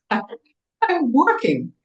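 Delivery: high, loud squealed retort; hammed-up high-pitched protest; mock exasperated shriek. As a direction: squeal it back over the noise. Shrieking back at her mother mid-exercise.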